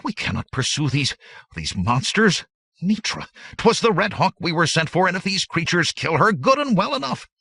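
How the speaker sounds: high, loud frightened babble; babbling. Acted. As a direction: gabble on in rising panic. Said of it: "the slender man babbled on".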